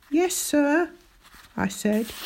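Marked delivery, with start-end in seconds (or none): 0.1-0.9 high-pitched short admission; meek schoolboy. A narrator's reading. Boy admitting to Captain, meek. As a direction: answer up as a cornered pupil.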